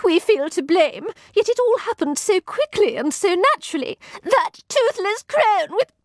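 high, tearful lady's voice; choked between sobs. A narrator's reading. Satin explaining the loss to her visitor, tearfully choked. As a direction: get the words out through tears. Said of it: "choked Satin between sobs".

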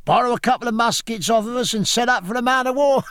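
a hint of rowdy seamen, pitched high; jeering sailors. Sailors jeering at the young gentleman, lightly voiced and high. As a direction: call out a high, teasing jibe. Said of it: "laughing at their own wit".

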